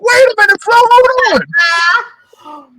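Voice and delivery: high drawn-out plea; pleading protest. Retold or imitated. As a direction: stretch it out, begging her to stop. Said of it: "I was like".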